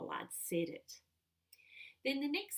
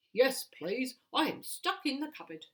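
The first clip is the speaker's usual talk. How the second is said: a touch louder, lightly voiced trapped man; Mister Pollard pleading; 3 semitones higher, 5 dB louder than usual. Shown as a demonstration. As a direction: plead a little from inside the cupboard. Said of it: "this is how it would sound".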